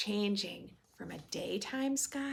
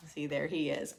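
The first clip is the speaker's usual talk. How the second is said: plain reading voice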